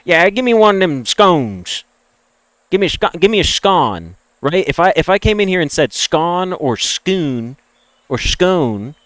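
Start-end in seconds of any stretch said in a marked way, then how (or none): 0.0-4.1 imitating a Southern yokel ordering scones; mock Southern accent. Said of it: "If I was a Southern moron".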